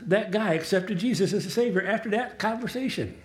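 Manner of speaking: ordinary talk, nothing marked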